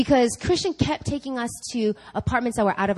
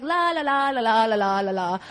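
tune out with a mock sing-song. Wife ignoring husband's raving with a sing-song la la. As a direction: drown him out like a kid. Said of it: "And I was like".

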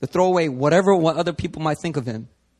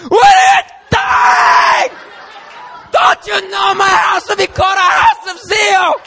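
much higher, louder yelling; furious shout; 8 dB louder than usual. Retold or imitated. Jesus in the temple, yelling at sellers. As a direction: bellow it in zealous rage. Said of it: "He's in there".

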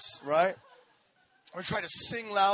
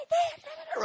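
1 high squeaky man; 5 dB quieter than usual. Retold or imitated. Friend relaying crush message.

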